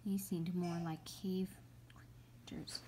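unvoiced, fading whisper; spooky whisper. A narrator's reading. Narrator whispering about creepy cave creatures. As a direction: hush like a secret fear.

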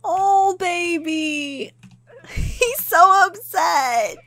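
high, slow, whimpering wail; hammed-up heartbreak. Player pities caught-out Kevin, tearful mock wail. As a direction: draw it out in tearful mock pity.

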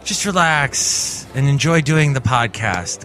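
normal talking voice

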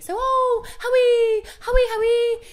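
put-on high whiny sing-song girl voice; whingy sing-song Hermione. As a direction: whine the name in a singsong. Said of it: "very whingy and sing-songy".

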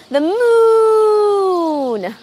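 announcing. Acted. Cow Martha tells Rob her dream, drawn-out announcement. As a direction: declare your dream grandly. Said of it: "Martha announced".

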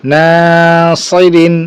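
"নাসিরিন" with long held "না", short endings; stretched reading. Shown as a demonstration. Teacher joining syllables for students, "না" drawn out. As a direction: sustain the first vowel of "নাসিরিন". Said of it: "টানা পড়ে চলে যাবো".

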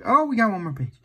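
ordinary delivery, nothing marked

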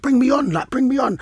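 impatient substitute, lightly acted; urging inner thought. Striker on the bench willing the manager on, urging. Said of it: "I'm thinking to myself".

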